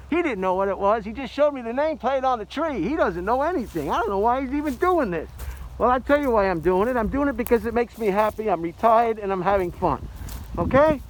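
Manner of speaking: loud, high, indignant comeback; defensive rant. Real, not acted. Retiree answering critics, loud and defensive. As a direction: fire back at the doubters.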